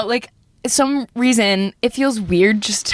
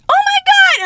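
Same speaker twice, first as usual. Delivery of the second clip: high, loud squeal; mock excited shriek; imitated shocked delight; 5 dB louder than usual. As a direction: act out a sudden thrilled realization. Aunt suddenly gets the shirt.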